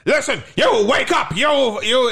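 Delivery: high shouted command; mock shout. Father shouting at the children to wake up. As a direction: bark at them to wake up.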